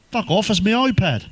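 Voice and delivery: high-pitched mock curt reply; indignant comeback, lightly acted; short retort. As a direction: imitate a snappy brush-off. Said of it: "He was very short with me".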